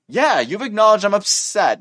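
acted, worked-up agreement; upset retort. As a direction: grudgingly agree while still mildly heated. Acted upset reply to observation.

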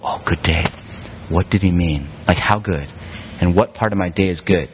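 a hint of comic confusion; mock puzzled. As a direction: pretend to puzzle over a greeting. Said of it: "most of you won't go".

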